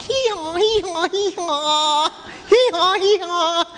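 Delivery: very high braying hee-haw; donkey braying. Retold or imitated. The preacher playing Balaam's donkey, high bray. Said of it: "The donkey was like".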